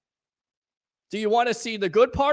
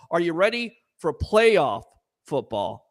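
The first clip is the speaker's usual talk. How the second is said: ordinary delivery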